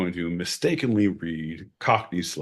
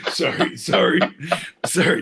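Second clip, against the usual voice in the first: laughing apology; 10 semitones higher, 4 dB louder than usual. Real, not acted.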